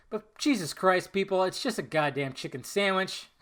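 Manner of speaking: ordinary delivery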